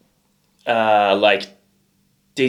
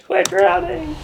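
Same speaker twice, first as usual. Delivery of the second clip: laughing. Real, not acted. Laughing at the joke.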